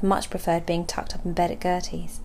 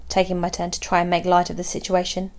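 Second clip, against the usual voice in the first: ordinary delivery, nothing marked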